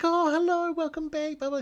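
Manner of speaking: high, sing-song welcome; a gushing hostess greeting a regular; mock sweet waitress voice; welcoming waitress.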